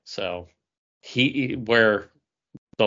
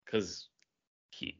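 normal talking voice

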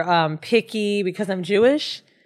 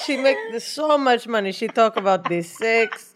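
a light put-on Israeli accent; mock Israeli dad accent. Wheezy joins in voicing her own father, light accent. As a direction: play the father, proud and light.

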